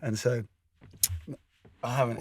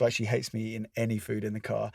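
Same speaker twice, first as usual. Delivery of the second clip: ordinary delivery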